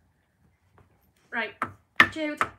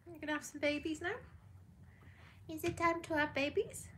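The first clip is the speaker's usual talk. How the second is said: high, coaxing voice to a pet dog; sing-song pet talk, pitched well up; 7 semitones higher, 5 dB quieter than usual. Real, not acted. Cooing to the pregnant dog.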